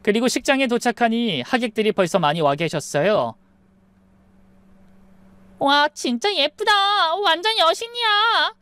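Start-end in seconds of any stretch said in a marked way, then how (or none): 5.6-8.5 very high, drawn-out gushing squeal; 감탄하는 친구. A narrator's reading. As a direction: gush over the bride in a high, sing-out voice. Friends gush over the bride. (높고 길게 끌며 호들갑스럽게 감탄)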